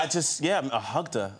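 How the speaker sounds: ordinary talk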